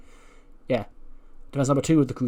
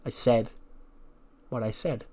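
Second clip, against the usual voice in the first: glaring firmness; 2 semitones lower, 8 dB quieter than usual. Acted. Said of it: "glares at him and goes".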